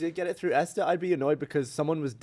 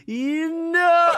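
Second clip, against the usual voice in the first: high squeal; 14 semitones higher, 5 dB louder than usual. Retold or imitated. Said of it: "he was like".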